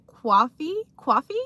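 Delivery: mock New York "coffee", drawn into "cwawfee"; joking New York-area vowel on coffee; New York accent. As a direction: echo coffee as a questioning New Yorker would. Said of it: "sometimes they kinda talk like that".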